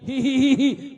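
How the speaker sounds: high, loud, slow giggling; the maid giggles under the whip, high and loud; maid's giggle. Acted. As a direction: cackle hee hee as the whipped maid. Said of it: "پھر ہنسنے لگی".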